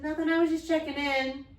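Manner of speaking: put-on high, loud kid's innocent answer; retold child's reply. Child answering dad after screaming for him, innocent retold reply. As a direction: toss it back high, like a kid.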